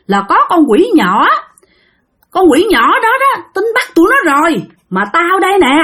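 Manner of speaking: high-pitched, stubborn spirit refusing mercy; acted outrage, high but not shouted; retold angry hollering. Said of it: "gào thét lên nói".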